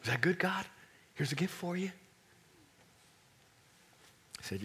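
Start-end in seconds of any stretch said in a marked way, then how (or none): 0.0-1.9 put-on a light, higher kid voice offering a gift; hopeful child holding up a present; eager little boy. God mimicking the preacher as an eager child, lightly voiced. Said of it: "you come to me like this".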